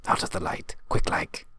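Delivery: hushed, urgent whisper. Acted. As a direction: whisper it fast, as if hiding from danger. Hiding from a monster.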